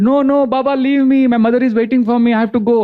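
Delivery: put-on high childlike voice begging to be let go; child Krishna pleading. Child Krishna begs Bilamangal to release him, high pleading boy's voice.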